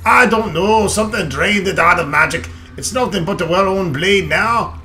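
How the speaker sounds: dwarf voice. Acted. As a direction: boom it out like an old dwarf. Reads dwarf lines in character.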